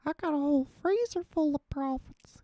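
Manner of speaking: put-on very high, thin old man voice; Herbert voice. Shown as a demonstration. Streamer trying a Herbert voice for chat, very high. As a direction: squeak it out like creepy old Herbert. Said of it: "I can't even do a Herbert voice".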